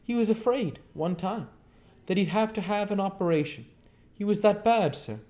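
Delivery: high, chattering landlady voice; lightly rustic landlady gabbling on; Mrs Hall's voice. A narrator's reading. Mrs Hall gossiping.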